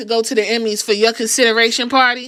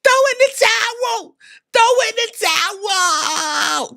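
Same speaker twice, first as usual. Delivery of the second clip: high shouting at the screen; yelling at TV; 10 semitones higher than usual. Retold or imitated. Fan shouting at Jay on TV to quit, high yell. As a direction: yell at the wrestler through the TV. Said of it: "I'm yelling at fucking Jay".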